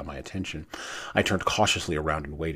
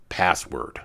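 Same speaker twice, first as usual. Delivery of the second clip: sharp, louder demand; 8 dB louder than usual. A narrator's reading. Marine demanding the password from a figure, loud and firm. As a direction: bark the word as a last warning. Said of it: "I demanded".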